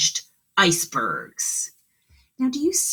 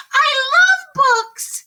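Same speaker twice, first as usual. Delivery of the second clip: very high, loud, slow squeal of delight; delighted mouse; 8 dB louder, slower than usual. A narrator's reading. The mouse telling Duncan, squealing with delight. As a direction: squeal it like a tiny mouse in love with books. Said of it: "said the mouse".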